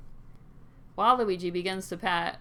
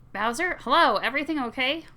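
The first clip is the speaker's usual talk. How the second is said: loud, higher call into the room; loud higher hello; 7 semitones higher, 6 dB louder than usual. Acted. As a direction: call out from the doorway. Daisy calling out.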